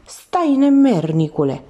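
high, loud, drawn-out cry; girl screaming after her attacker; girl's scream. A narrator's reading. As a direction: cry out for him to stop, stretching the words. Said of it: "Țipetele fetei dispăruseră".

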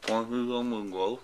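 slurred, numb lips; mumbling through a numb mouth; numb from the dentist. Real, not acted. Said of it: "I can't move my mouth".

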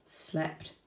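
whispered, drowsy slept; sleepy whisper. A narrator's reading. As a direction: breathe it out like dozing off. Sleepy wombat diary entry.

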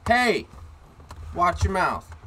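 high, loud call of reproof; nicely yelling. Retold or imitated. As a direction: call it across the park, firm but friendly. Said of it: "kinda nicely yell".

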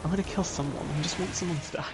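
normal talking voice

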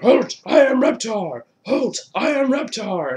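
loud, high cartoon monster proclamation; imitated cartoon catchphrase, shouted; Reptar. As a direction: boom it out high like a toy-store monster. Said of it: "they bumped into Reptar? It was like".

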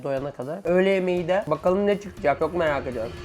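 ordinary talk

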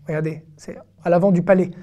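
normal talking voice

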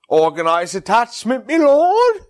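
hammed-up posh British accent, climbing high on me lord; mock British accent. Shown as a demonstration. British accent shown off to a friend, hammed-up. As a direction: show off a silly British accent. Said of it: "I'll show you an accent".

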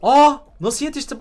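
high, loud disbelief; shocked outburst. Real, not acted. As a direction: blurt it out in shock. Opponent catches up suddenly.

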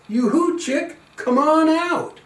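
high, loud call; shouting. Acted. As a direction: shout for the chick to hatch. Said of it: "everybody shout".

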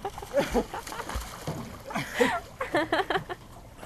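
breathless squealing laugh in the cold; high gasping laugh. Real, not acted.

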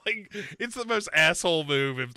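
normal talking voice

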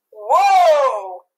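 loud yell after her. Acted. Joe yelling after the fleeing Cindy, loud call. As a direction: shout it as she runs off. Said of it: "yelled Joe".

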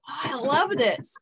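a touch of blissful soul; soul loving it. Pretend. The soul feeling love's vibration, mildly delighted. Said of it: "from the soul perspective".

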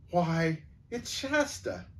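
sudden high gasp of recognition; shocked, voice jumping up on the name; gasped surprise. Acted. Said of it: "she gasped and said".

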